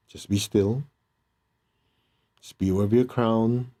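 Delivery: calm meditation guide voice; guided meditation. Acted. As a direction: lead them inward, gently. Leads a meditation.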